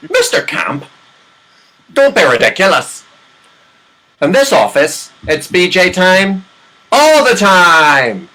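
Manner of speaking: put-on Chinese accent, drawn out and rising loud at the end. Recruiter scolds the comedian in a Chinese accent. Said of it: "that Chinese accent, though, pretty good".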